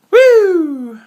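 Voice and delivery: high, loud festival cheer; joyful shouted woo; excited whoop. Real, not acted. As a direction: whoop like you're in the crowd.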